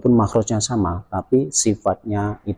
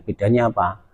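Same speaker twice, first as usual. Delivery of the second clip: ordinary talk, nothing marked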